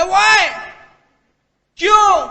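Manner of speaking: high, shouted demand for a reason; shocked protest. Retold or imitated. Applicant refused by the MD, shouting in shock. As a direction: cry out in disbelief.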